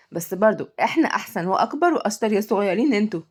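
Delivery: ordinary delivery, nothing marked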